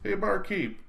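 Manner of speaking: high call across a noisy bar; yelling across room. A narrator's reading. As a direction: call out to the barkeep. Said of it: "she yelled across the room".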